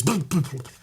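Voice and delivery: comic bloops of a sinking dinghy; playful glug of something going under; imitated bubbling. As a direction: make little bubble noises with your mouth. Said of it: "and just goes".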